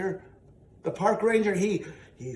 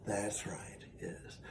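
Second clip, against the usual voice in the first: whisper; 10 semitones lower, 15 dB quieter, slower than usual. Real, not acted. Soft agreement.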